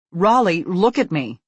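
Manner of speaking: loud stern mother; stern command. A narrator's reading. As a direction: make him look at you. Mad mother demands attention.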